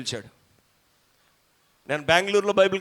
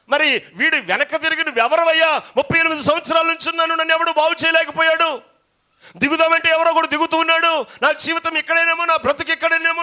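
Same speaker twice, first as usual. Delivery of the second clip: high, loud pulpit shouting; fiery preaching; 11 semitones higher, 8 dB louder than usual. Acted. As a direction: preach it with full force. Preaching at full force.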